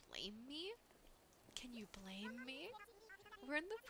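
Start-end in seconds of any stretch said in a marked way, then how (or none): 1.5-2.7 slow whisper, no voice; whispered aside. Shown as a demonstration. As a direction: murmur it slowly as a secret aside. Repeated as a whisper.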